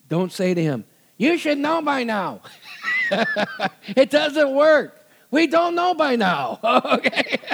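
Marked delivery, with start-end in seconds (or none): 1.2-2.4 high-pitched mock reproach; put-on wifely scolding, pitched way up. Wife to husband who doesn't understand, high mock reproach. 4.0-7.4 high, drawn-out comic plea; amused protest. Real, not acted. Preacher to ladies on behalf of husbands, laughing protest.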